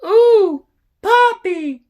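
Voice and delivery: put-on very high, loud little-girl squeal; excited little girl. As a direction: coo at a puppy in a piercing child's voice. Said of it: "She goes".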